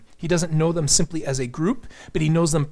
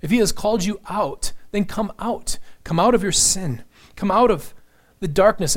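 higher pitch, urging them to come out; urgent preaching call; 5 semitones higher, 3 dB louder than usual. Acted. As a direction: plead with rising urgency.

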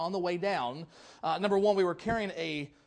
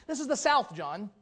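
imitating Randy; 6 semitones higher, 8 dB louder than usual. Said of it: "He says".

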